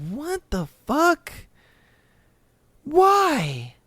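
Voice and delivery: high, falling cry of bafflement; baffled disbelief. Real, not acted. Host reacting to poop on the bathroom wall, baffled. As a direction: let each question out high, aghast.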